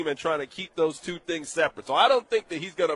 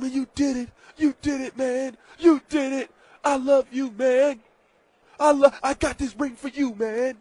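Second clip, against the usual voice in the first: higher, choked-up mock sobbing brother; mock crying brother; 8 semitones higher, 3 dB louder than usual. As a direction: ham up a weepy brother congratulating. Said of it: "you know Jason is crying".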